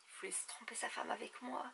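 hushed scandalized whisper. Real, not acted. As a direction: whisper it like a secret.

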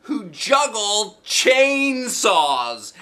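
high, slow, gleeful; Polly proposing chainsaw jugglers; excited boy. A narrator's reading. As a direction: savor the crazy idea. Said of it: "real excited".

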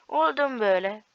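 teasing a beaten opponent; mocking taunt. Real, not acted. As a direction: throw it back mockingly. Taunting repeated question.

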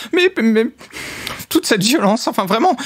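put-on high, indignant old lady; vieille dame outrée. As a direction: clutch your pearls, shocked granny. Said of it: "en mode". (vieille dame scandalisée, voix aiguë)

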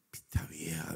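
whispered aside; whispered grumble to himself; muttered under breath. Retold or imitated. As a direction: whisper it in private annoyance. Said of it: "dije yo".

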